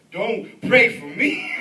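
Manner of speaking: loud, drawn-out comic plea breaking into laughter; mock alarm. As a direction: beg him in pretend panic, slowly and loudly. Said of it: "And then I said".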